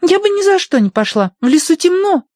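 put-on high, loud child voice; little girl voice. A narrator's reading. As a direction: speak like a demanding little girl.

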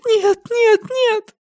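very high, tearful wail; anguished crying plea; sobbing. A narrator's reading. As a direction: refuse through sobs. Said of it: "зарыдала Силья".

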